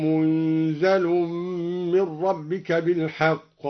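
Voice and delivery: منزل read munzal with sukun on the nun; slow recitation, نون still and زاي light; Duri reading munzal. Shown as a demonstration. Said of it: "هكذا".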